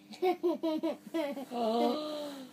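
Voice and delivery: mock horrified gasp; dramatic gasp. Reader dreading the nonsense words, dramatic gasp. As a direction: gasp before the plunge.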